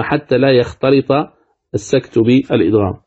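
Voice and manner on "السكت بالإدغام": ordinary talk, nothing marked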